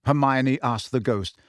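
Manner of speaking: usual speaking voice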